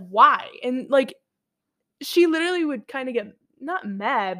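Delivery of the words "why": exasperated why. Retold or imitated. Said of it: "And she was like".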